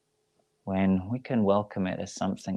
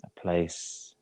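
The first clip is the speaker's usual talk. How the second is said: measured Yoga Nidra voice, long pauses between phrases; guided meditation. Real, not acted. Yoga teacher talks listeners through letting the body go, guided meditation voice. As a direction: guide them gently into stillness, leaving space after each phrase.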